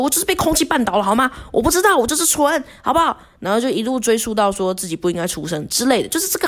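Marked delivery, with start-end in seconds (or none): 0.0-3.2 被 stressed, fast and much higher with exasperation; angry shout. Retold or imitated. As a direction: snap back at the boss in fed-up anger. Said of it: "很生气的大喊说". (很生气地大喊 我就是被空气绊倒了)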